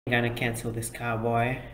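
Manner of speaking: ordinary talk, nothing marked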